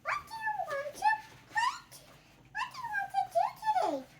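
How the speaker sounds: high cheerful piglet voice asking; bright high pig voice, eager; Piggie voice. Acted. Kid voices Piggie asking Gerald.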